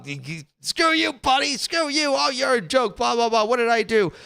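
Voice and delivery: high, dismissive rant; mock Portnoy comeback. Imitating Dave Portnoy firing back at a heckler, high and scornful. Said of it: "He just goes in".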